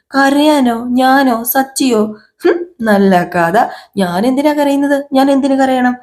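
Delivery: indignant denial. Acted. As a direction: act affronted at the very suggestion. Acted denial of having cried.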